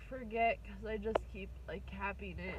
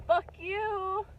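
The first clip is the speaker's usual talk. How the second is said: high, loud mock snarl as life itself; life says fuck you; 9 semitones higher, 8 dB louder than usual. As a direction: spit it out as spiteful life, half laughing. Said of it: "life just has this way of being like".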